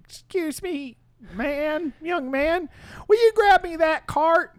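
put-on high, slow old-lady voice; old lady calling. Old lady calls out for help.

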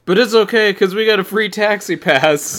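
voicing the carefree thugs, high and laughing; laughing mock reply. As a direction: toss it back cheerfully while laughing.

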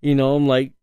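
ordinary talk

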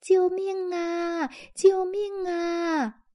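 high, slow, drawn-out cry for help; the lost teddy bear calling out for help. A narrator's reading. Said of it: "艾玛听见有人喊叫".

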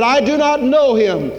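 loud, high, vehement denial; acted outburst of angry denial; swearing denial. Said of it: "began to curse and swear".

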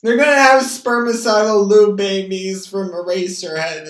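high, loud yelling. Real, not acted. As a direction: shout it out excitedly. Still yelling at mom on phone.

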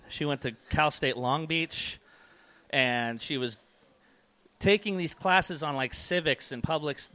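ordinary delivery

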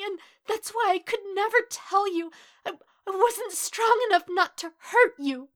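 high, loud tearful pleading; anguished crying confession; choked with misery. A narrator's reading. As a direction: stammer it out through tears. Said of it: "warbling and choked with misery".

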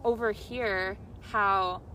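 ordinary talk

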